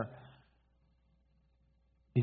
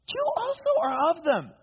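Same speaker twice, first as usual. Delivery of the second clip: high-pitched, pointed accusation; accusing bystander; 3 dB louder than usual. Acted. Bystander singles out Peter by the fire, accusing. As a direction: play the accuser in a raised voice.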